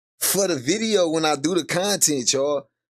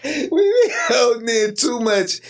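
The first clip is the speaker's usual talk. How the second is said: high, drawn-out squeal of real laughter; crying with laughter; slower than usual. Real, not acted. Streamer cracking up on a live, high tearful laughing. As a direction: squeeze the words out through helpless laughter. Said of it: "I be crying and laughing".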